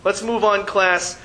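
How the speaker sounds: ordinary talk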